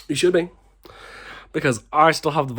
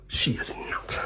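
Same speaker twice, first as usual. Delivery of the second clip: hushed, stunned disbelief; whispered she is not; shocked whisper; 8 dB quieter, slower than usual. Retold or imitated. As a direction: breathe it out in disbelief.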